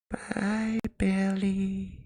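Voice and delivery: very high, soft little creature voice; Mogwai accent. A narrator's reading. A small creature saying goodbye to his boy in a high, soft squeak. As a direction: squeak it out like a tiny furry creature. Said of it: "tinged with a Mogwai accent".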